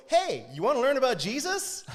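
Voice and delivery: put-on high, loud hail to kids; pushy pitch, hammed up for laughs; overeager mock greeting. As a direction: come on far too strong. Overeager approach to group of kids.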